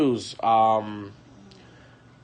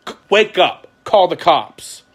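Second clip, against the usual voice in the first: higher, louder urgent command; urgent call; 6 semitones higher, 6 dB louder, slower than usual. Retold or imitated. Friend phoning the wife, urgent and raised. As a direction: wake her up fast.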